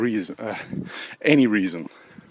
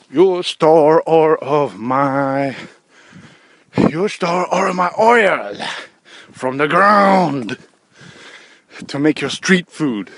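slow, raised, drawn-out North Korean caricature; angry North Korean accent, loud and laboured; mock North Korean accent; 4 semitones higher, 5 dB louder, slower than usual. Acting North Korea angry at China.

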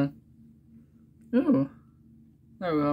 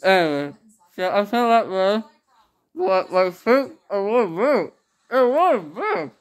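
speaking around gauze wads; talking with gauze in mouth; 5 semitones higher, 3 dB louder than usual. Real, not acted. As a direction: gauze clenched between teeth.

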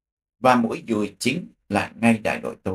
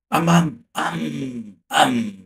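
rocket blasts; 5 semitones higher, slower than usual. Retold or imitated. Rockets fired, imitated booms.

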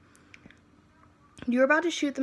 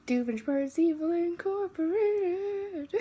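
jingle voice; 7 semitones higher, much slower than usual. Pretend. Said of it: "That's how we say time skip".

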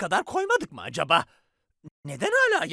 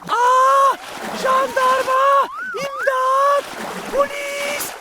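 very high, loud screaming; panicked screams for help; 7 dB louder than usual. Acted. Drowning villain screaming to everyone for help. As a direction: scream like you are drowning and cannot swim.

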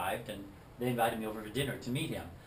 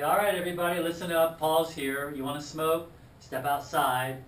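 louder, higher barked order to the room, slowing at the end; commanding announcement; 5 semitones higher, 7 dB louder than usual. Retold or imitated. David tells the bikers about smoking, loud and commanding. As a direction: lay down the law to everyone.

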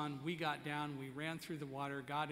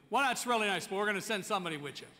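insistent firefighter; 4 semitones higher, 7 dB louder, faster than usual. Retold or imitated.

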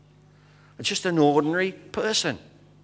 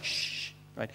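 retold whisper, secretive; whispered hush; 3 semitones lower, 18 dB quieter than usual. Prime Minister hushing the pastor on speakerphone, whispered. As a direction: hush the room before speaking.

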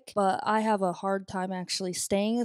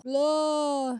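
groggy blah; 4 semitones higher than usual. Acted. Acting out waking up groggy.

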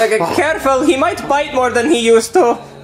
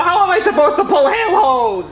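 normal talking voice

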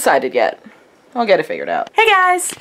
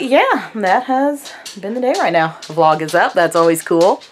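ordinary talk, nothing marked

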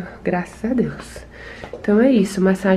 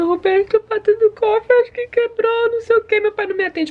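much higher, imitating a frantic crying plea; imitating her crying; 13 semitones higher than usual. Retelling her call to Roberto about the dog, in a mock crying voice. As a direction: replay the panicked call to Roberto, pitched way up. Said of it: "eu liguei pro Roberto chorando".

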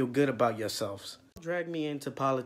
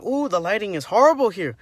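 ordinary talk

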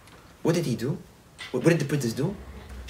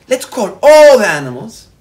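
big summoning call, louder and higher; 6 semitones higher, 16 dB louder than usual. Acted. Giant summoning animals from all the world, loud calling out. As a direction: ring it out to every animal.

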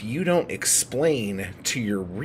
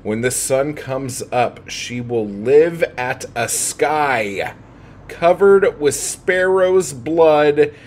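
dramatic verse reading building up; poem reading; 4 dB louder, slower than usual. Acted. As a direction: let each strange image ring out slowly. Reads the poem aloud.

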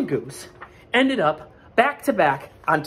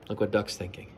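ordinary delivery, nothing marked

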